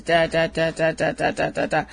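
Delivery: low droning monotone, no melody. Retold or imitated. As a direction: drone the syllables low and lifeless. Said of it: "in this low monotone voice".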